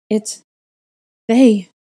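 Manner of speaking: stammer, unable to finish. A narrator's reading. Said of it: "I stammered".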